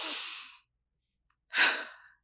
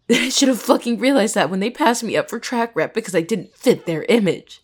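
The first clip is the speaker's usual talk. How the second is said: bitter despair; 2 semitones higher, 4 dB louder than usual. Acted. Student admits hope of changing things failed, anguished. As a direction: pour out bitter regret at having hoped. Jess despairs at being passed over.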